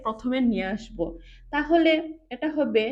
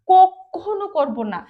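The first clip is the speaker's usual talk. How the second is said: কখনো very loud and slow, drawn out and falling; stress on never; 5 semitones higher, 9 dB louder, slower than usual. Shown as a demonstration. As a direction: lean hard on কখনো. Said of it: "never শব্দের উপরে জোর দিচ্ছি".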